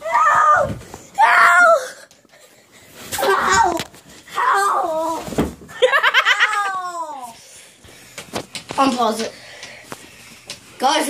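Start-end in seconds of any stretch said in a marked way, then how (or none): 0.0-7.4 high, laughing cries of help; being tickled. Real, not acted. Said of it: "Guys, I'm ticklish!".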